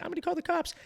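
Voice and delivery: very high mimicked woman's voice; high-pitched impression of an upset woman; imitated woman. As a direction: mimic her threat in falsetto. Imitating the woman's threat again.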